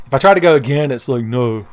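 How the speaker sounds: usual speaking voice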